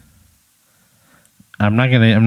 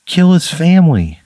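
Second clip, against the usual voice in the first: higher, exasperated objection; indignant protest; 7 semitones higher, 4 dB louder than usual. Acted. Player defends his heckle to the game master, indignant. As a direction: argue the unfairness.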